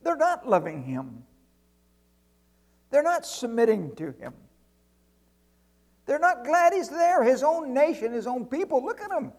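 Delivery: high, urgent outcry building from ordinary pitch; exasperated angel. Acted. Angel watching people reject their King, exasperated. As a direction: build to a high, loud acted complaint. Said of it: "I'd talk like that".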